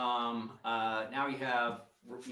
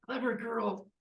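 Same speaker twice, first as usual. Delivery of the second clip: higher, seething praise that ends loud; impressed but furious villain; grudging villain; 11 semitones higher, 6 dB louder, slower than usual. Acted. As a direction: admit she's impressed, then spit out the anger. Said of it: "you hear".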